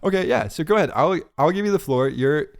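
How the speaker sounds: ordinary talk, nothing marked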